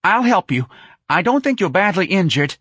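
high, loud nervous tenor; anxious reassurance. Acted. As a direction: plead with the injured man anxiously. Frightened manager offers help.

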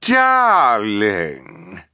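loud drawn-out theatrical cry; effusive exclamation. A narrator's reading. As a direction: call out a gushing, stretched-out greeting. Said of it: "it cried".

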